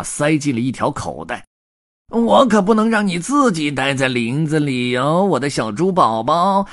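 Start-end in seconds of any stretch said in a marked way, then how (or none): 2.1-6.6 higher, louder storybook hobo voice; red-bearded tramp talking to his prey; grumbling tramp. A narrator's reading. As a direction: croon at a captured piglet in a raised character voice. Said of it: "他嘟囔着扎上口袋".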